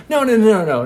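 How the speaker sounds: high, loud string of noes; acted flustered objection; hasty protest. Peter stops Jesus from leaving.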